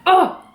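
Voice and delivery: high, frustrated "oh"; exasperated groan. Real, not acted. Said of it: "they make me so angry".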